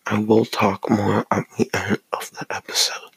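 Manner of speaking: soft unvoiced ASMR whisper. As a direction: whisper it close to the mic. ASMR whispered aside to viewers.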